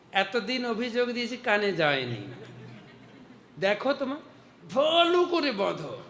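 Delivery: high, scolding gopi egging the mother on; angry village woman. Retold or imitated. A gopi vents her anger at Gopal, high and scolding. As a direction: nag like a fed-up neighbour finally getting her way. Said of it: "কিছু গোপী তো রাগ ঝেড়ে দিল".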